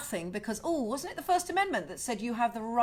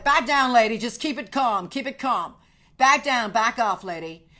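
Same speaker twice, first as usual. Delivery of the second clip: mock police order; 7 dB louder than usual. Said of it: "the police are like".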